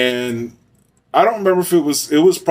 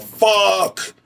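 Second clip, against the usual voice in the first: mock panicked yell, pitched high; a startled driver's curse, jumped up in pitch; retold yell; 8 semitones higher than usual. Slamming on the brakes, driver yells in mock alarm. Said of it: "I slammed on the brakes and I yelled".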